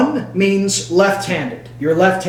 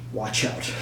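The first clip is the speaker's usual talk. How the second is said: hushed, conspiratorial warning; whispered warning; 9 semitones lower, 11 dB quieter than usual. Acted. Warning viewers about Turnigy in a whisper. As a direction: whisper it like a secret tip.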